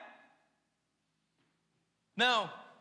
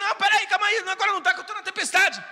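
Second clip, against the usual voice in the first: higher, louder, panicked wave-off; frantic refusal; 7 semitones higher, 8 dB louder than usual. Retold or imitated. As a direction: brush them off mid-storm, raised and rushed. Recusa ajuda na tempestade.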